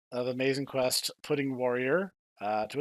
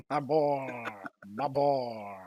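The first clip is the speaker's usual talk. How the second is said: CD-i king voice; much slower than usual. Retold or imitated. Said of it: "king from the Zelda C D I games".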